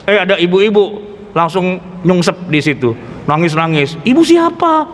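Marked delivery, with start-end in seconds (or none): 4.0-4.9 startled question. Retold or imitated. Startled mourners ask.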